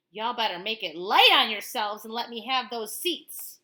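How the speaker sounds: threatening driver. Acted. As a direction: warn them to move, or else.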